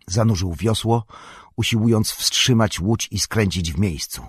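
ordinary delivery

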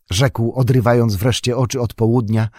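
ordinary delivery, nothing marked

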